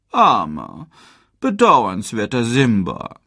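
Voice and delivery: slow, drawn-out mocking pity, villain voice; sneering. A narrator's reading. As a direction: savor every syllable as a gloating taunt. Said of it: "höhnte Scar".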